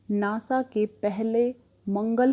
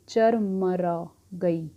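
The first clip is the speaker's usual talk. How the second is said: "चरमरा गई" said very slowly, syllable by syllable; drawn out slowly; much slower than usual. Shown as a demonstration. Teacher spelling out "चरमरा गई" for students, very slowly. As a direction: stretch out every sound of "चरमरा गई". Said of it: "इस word पे ध्यान दो".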